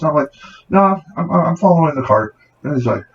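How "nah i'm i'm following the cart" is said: mock innocent. Taunting shoplifter, pitched up.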